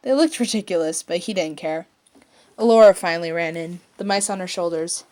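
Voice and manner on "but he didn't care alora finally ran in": plain reading voice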